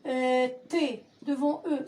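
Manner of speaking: ordinary talk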